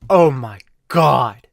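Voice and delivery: loud, slow outraged voice; scandalized gasping. Retold or imitated. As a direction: clutch your pearls, loud and slow. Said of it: "people are like".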